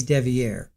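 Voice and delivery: "Devier" said dev-ee-AIR, with a final -air; "Devier" rhymes with "air" at the end; his name Devier. Shown as a demonstration. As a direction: land on the -ier ending of "Devier". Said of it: "He's Devier".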